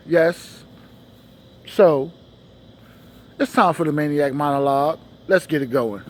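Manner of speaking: ordinary delivery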